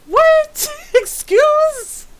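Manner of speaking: high, loud incredulous squawk; mock disbelief. Reacting to friends texting side by side, loud mock disbelief. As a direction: squawk it in exaggerated shock. Mock shock at absurd texts.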